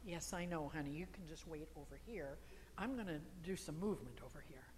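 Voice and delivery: low, softened, placating aside; gentle acknowledgment. Acted. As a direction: humour a nagging inner voice kindly. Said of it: "Acknowledge it if that's what comes up and say".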